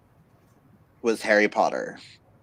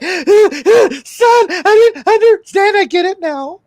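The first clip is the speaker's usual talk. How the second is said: mock sobbing mom; 8 dB louder than usual. Mom tells son she finally understands crying. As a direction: ham up the weepy mom. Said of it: "she's like".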